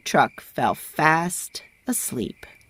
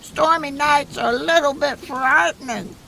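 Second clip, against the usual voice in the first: nervous goose; 8 semitones higher than usual. A narrator's reading. Goose admits fear of lightning, high and fretful. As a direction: fret about the storm as a goose. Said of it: "said Goose".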